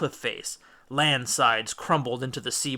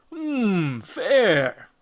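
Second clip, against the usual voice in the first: higher, grudging giant hum; giant tasting; 7 semitones higher, 5 dB louder than usual. A narrator's reading. Polyphemus tasting Odysseus's wine, grudging. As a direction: savour it like a grudging monster.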